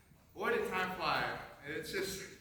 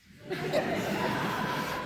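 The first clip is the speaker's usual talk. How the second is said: tearful laugh; 8 dB louder than usual. Real, not acted. Laughs at losing rank.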